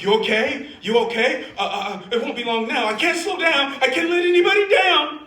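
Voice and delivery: high, loud, rising panic; frantic doctor. Acted. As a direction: build from urgent checking to a loud frantic cry. Acted frantic doctor driving.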